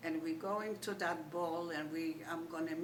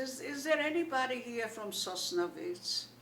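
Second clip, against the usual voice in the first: calling out; 6 semitones higher, 4 dB louder than usual. Retold or imitated. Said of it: "And I said".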